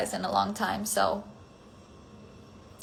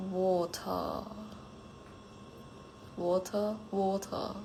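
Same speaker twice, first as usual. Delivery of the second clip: softly attempted British accent, holding back laughter; 11 dB quieter, slower than usual. Shown as a demonstration. Streamer on a viewer's dare not to laugh, British accent. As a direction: say it quietly and carefully in a British accent. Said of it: "Can you say water in a British accent".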